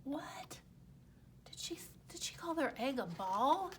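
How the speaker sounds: unvoiced shocked whisper. Real, not acted. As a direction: whisper it in disbelief. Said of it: "narrator aside whisper".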